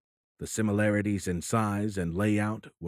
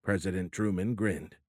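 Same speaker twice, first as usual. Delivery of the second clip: ordinary delivery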